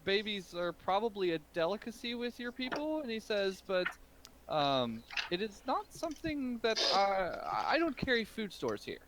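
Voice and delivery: put-on the old merchant feigning innocence to the ogre; flustered shopkeeper. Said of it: "he's like".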